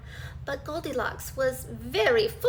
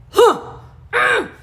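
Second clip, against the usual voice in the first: loud, high gruff grumble; grumbling; 4 semitones higher, 10 dB louder than usual. Acted. Daddy Bear grumbling at his rumpled bed. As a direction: grumble it like a cross big bear. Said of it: "he grumbled".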